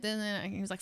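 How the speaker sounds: ordinary delivery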